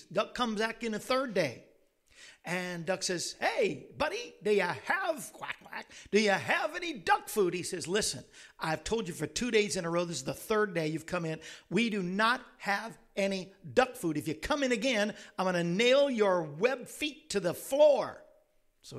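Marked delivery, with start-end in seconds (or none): 3.4-7.5 high, loud, punchy cartoon duck; cheeky duck calling out, with quacks; pestering joke duck. Joke duck pestering store owner. 7.9-18.2 mock-angry threat, building louder; comic fed-up rant, slowing on the threat; exasperated store owner.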